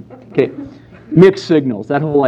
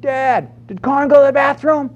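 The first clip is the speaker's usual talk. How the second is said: put-on much higher, eager child's shouting; excited little boy. Young son running through the kitchen to his dad, high and thrilled. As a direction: burst in thrilled as a small boy.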